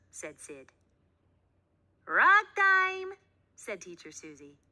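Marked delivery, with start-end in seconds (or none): 2.0-3.1 high loud singsong call; calling the class. A narrator's reading. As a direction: call the kids to the rug. Teacher calls class.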